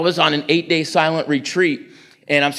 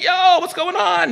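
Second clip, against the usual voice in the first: surprised greeting; slower than usual. Retold or imitated. Greets a man who came back high.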